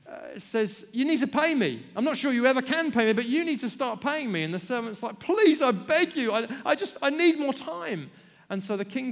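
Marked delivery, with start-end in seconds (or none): 0.9-4.4 loud, higher stern demand; demanding king. Acted. King demands payment from servant. 5.2-8.1 desperate begging. Acted. Servant begs the king.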